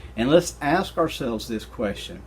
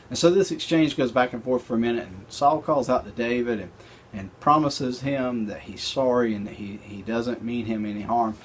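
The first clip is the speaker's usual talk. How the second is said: ordinary talk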